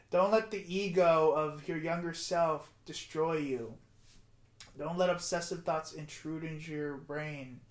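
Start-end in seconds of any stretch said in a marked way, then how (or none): none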